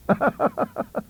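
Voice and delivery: high, self-satisfied laugh; pleased chuckle. Retold or imitated. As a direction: chuckle at your own witticism. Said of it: "pleased at his little literary joke".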